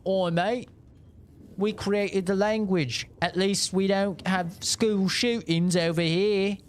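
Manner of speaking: put-on lampoon a smug Brit in a British accent; mock British accent. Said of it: "the British being like".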